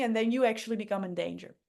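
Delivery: normal talking voice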